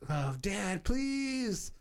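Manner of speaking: rising to a high plea; mock begging his father; pleading Luke. Retelling Luke begging Vader, mock plea. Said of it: "Luke's like".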